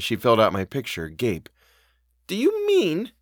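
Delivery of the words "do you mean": high, hesitant dread; faltering. A narrator's reading. Housekeeper dreads the governess's answer, high and faltering. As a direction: begin high and hang back, afraid to finish. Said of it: "she faltered".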